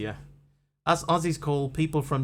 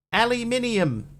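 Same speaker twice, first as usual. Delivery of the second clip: much higher, louder blurted answer; excited answer; 10 semitones higher, 5 dB louder than usual. Real, not acted. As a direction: shout the answer out eagerly.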